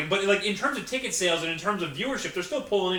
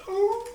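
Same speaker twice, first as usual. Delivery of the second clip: mock theme-song wail. Mimicking Wolfpack theme music.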